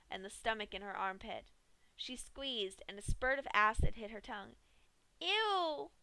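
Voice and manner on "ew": high, sharp recoil; disgusted ew. A narrator's reading. Pinky tastes stomach acid, disgusted. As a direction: spit out the taste. Acid hits her tongue.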